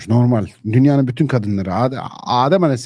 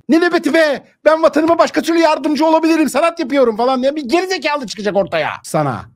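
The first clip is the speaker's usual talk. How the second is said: much higher, whiny put-on voice of a pampered young man; mocking effeminate youth. Preacher mocking a young man dodging guard duty, high whiny voice. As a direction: sneer at him by whining his excuse.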